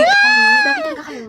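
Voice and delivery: high, drawn-out squeal; giddy squeal. Acted. Squealing while telling of crush. (patiling mataas at pahaba)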